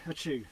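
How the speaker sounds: loud real achoo; sudden loud sneeze; sneezing. Real, not acted. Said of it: "I wanna sneeze".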